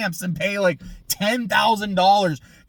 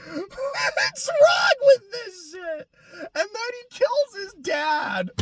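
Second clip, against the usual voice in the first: much higher, slow, strained yell; exasperated shout; 13 semitones higher, slower than usual. Real, not acted. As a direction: cry out at how absurd it is. Ranting in disbelief.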